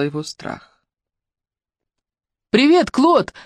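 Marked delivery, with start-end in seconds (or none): 2.5-3.3 high, loud call across the dark; shouted greeting. Acted. Ruby shouts a greeting to Claude on the rope. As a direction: call out to him as if he's far off. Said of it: "крикнула она".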